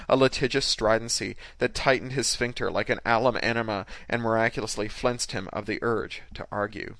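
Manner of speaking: usual speaking voice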